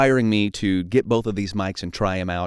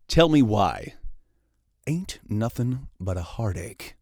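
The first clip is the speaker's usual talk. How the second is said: slow, hushed dramatic reading of lyrics; dramatic voiceover reading; 4 dB quieter, slower than usual. Shown as a demonstration. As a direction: read it like a movie trailer. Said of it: "dramatic voiceover reading".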